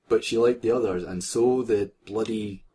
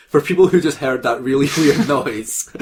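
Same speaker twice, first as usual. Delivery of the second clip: laughing; 4 semitones higher, 7 dB louder than usual. Real, not acted. Addressing listeners, laughing.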